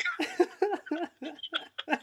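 sudden high burst of laughter; delighted high laugh. Real, not acted. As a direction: cackle out high. Big laugh.

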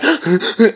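jadeos entrecortados imitando un llanto; llanto con hipos. Shown as a demonstration. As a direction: imita el hipo de llorar a moco tendido. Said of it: "con hipos, en plan". (hipidos de llanto fingido)

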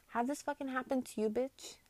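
normal talking voice

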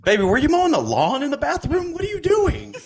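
high-pitched comic shout of disbelief; hammed-up baffled partner calling through a door; mock alarmed partner. Acted partner shouting into the bathroom.

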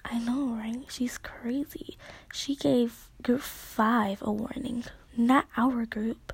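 a soft hushed reply; hushed whisper. Retold or imitated. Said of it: "so Hannah goes".

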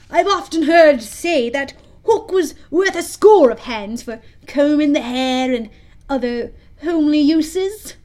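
high, loud character voice; Smee the pirate. A narrator's reading. Smee praising his captain's hook, in a high, loud sidekick voice. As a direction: chatter up high as the simple sidekick. Smee's voice in story.

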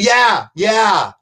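mock impatient yell; retold screaming. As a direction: imitate an exasperated star shouting confirmation. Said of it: "starts screaming at the entire staff".

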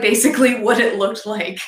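ordinary delivery

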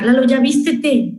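normal talking voice

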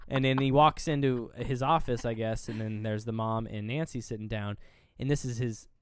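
ordinary delivery

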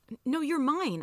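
hurried attempt to set someone straight; trying to explain. A narrator's reading. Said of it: "I tried to explain".

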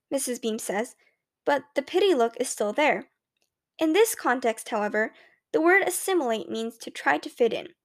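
usual speaking voice

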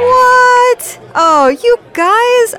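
high, loud mock astonishment; surprised shriek. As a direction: gasp out the shock. Host reacts to surprise party.

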